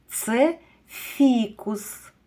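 Це фікус slow with weight on Це; slow confirming answer for children; slow stressed answer. Shown as a demonstration. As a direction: slowly confirm Це фікус, leaning on Це. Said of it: "я згодна з вами".

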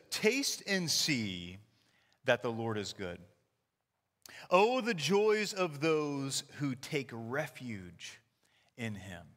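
slow, deliberate reading of the verse; scripture reading. Shown as a demonstration. Pastor reads Psalm to congregation, slow scripture reading. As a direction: read the psalm slowly, savoring each phrase. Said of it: "It says".

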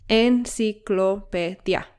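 "enciclopedia" slowly split, en-ci-clo-pe-dia; syllable by syllable. Shown as a demonstration. Teacher counting five syllables for learners, syllable by syllable.

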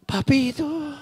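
high, soft weepy "Papito"; mock teary wife calling her husband; tearful wife. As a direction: whimper it like she's been crying. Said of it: "vino llorando".

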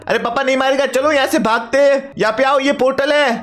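son's high, chatty voice; eager son. Acted. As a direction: play the excited kid calling out to papa.

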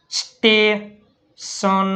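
each word drawn out slowly for learners; slow spelling drill. Shown as a demonstration. Teacher slowly sounding out words for students. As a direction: stretch every syllable so students follow. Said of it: "એસ ટી એ".

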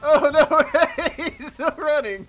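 high, loud, helpless laughter through words; laughing exclamation. Real, not acted. Gamer watches man still running, high laughing shout. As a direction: shout it while cracking up.

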